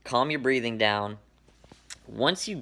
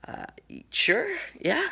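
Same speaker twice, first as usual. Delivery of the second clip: nervous reluctant yes. Retold or imitated. Instructor offers parachute tricks.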